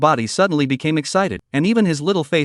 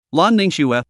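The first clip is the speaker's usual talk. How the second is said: ordinary delivery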